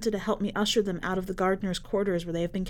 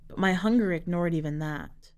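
plain reading voice

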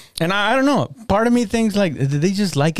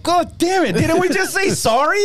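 high, strained outburst; hammed-up exasperated cry; mock exasperation. Boyfriend after the apology gets reopened, high mock exasperation.

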